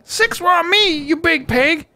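high, put-on goofy brute voice; squeaky growling brute, yelled playfully; Tiny voice. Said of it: "doing the Tiny voice".

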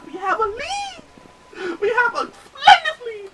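high, slow theatrical crowing; mock-grand triumph with a laugh; hammed-up squeal. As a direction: stretch it out like a ham actor. Hammed-up celebration.

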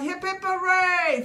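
high, loud cheer; rousing storybook hooray; joyful yell. A narrator's reading. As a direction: cheer like a jubilant little crowd. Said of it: "jumped for joy and yelled".

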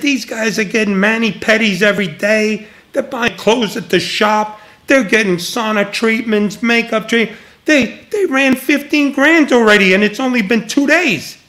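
high-pitched exasperated rundown, building at the end; indignant complaint. Retold or imitated. Manager complains about the guests' bills, indignant and exasperated. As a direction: retell an outraged tally of someone's spending.

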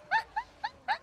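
high, loud burst of laughter; short high-pitched giggling laugh. Real, not acted. Laughs after boasting it's easy.

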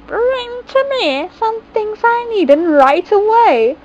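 a hint of a high witch; lightly voiced good witch. A narrator's reading.

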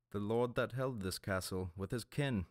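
a hint of a sneering pirate; lightly voiced vile captain. A narrator's reading. Captain answering the priest, mild sneer. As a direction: toss it off like a reaver.

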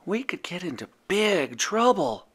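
nervous little whisper; hushed anxious whisper. A narrator's reading. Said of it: "whispered Furball".

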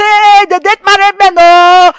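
loud, high scandalised exclamation; mock gossip outcry. Mocking the gossips, loud and scandalised. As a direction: shout it like gossips mocking her. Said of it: "they'll say".

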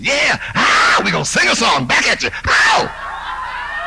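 loud, wild, shouted stage yelps ending in a high squeal; imitating a drugged-out singer. Comedian imitating an unnamed singer whooping on stage. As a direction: ham up a spaced-out singer whooping at the crowd. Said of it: "I'll just do a little imitation of it".

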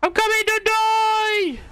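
very high, stretched-out battle cry; high yell. Real, not acted. Rushing to join a friend in the fight, yelling. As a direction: scream it long and high.

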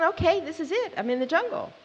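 high-pitched, mildly excited inner voice; high, keyed-up self-quote; eager realization. Retold or imitated. Acting out her first morning's thought.

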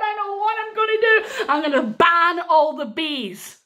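high, loud, wailing declaration; indignant king. Acted. Stung king announcing a ban, indignant outburst. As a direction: declare it high-pitched and worked up.

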